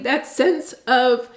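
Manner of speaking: normal talking voice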